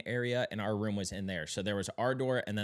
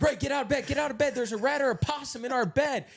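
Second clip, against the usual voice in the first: high, loud frantic warning; high, loud panicked shout at Ray; panicked yell; 14 semitones higher, 5 dB louder than usual. Retold or imitated. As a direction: scream at your buddy to get up.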